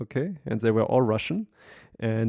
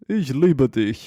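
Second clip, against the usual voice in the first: mock threatening German; 3 semitones higher, 7 dB louder, slower than usual. Mock menacing German phrase.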